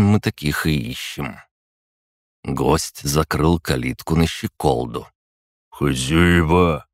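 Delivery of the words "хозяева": slow, lowing call; mumbling lout. Acted. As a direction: drag it out like a lout. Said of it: "промычал он".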